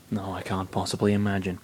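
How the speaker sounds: ordinary delivery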